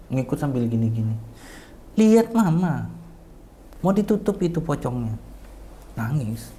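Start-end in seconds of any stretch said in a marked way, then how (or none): 2.0-5.2 raised, high urging; retold snapping. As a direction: re-enact a pleading order to a parent. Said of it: "saya sentak". (desakan bernada tinggi)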